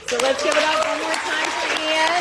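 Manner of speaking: loud, high rally call to the crowd; cheering call. Real, not acted. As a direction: hype up the room for Ian. Host calls for applause.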